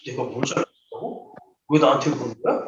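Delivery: aggrieved self-pity. Retold or imitated. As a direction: sulk it out quietly, playing the victim. Narcissist complains about being blamed.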